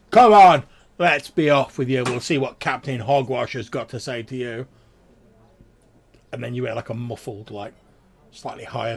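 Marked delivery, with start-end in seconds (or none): 0.1-4.7 booming, much louder gruff call; loud guard voice. Acted. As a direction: bark it out like a town guard hauling someone off. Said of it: "you hear a loud voice go".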